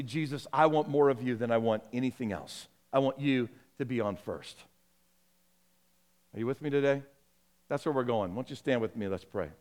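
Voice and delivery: low, soft invitation to prayer; hushed, earnest sermon ending; quiet pastoral close. Acted. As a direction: let the voice sink gently as you lead into prayer.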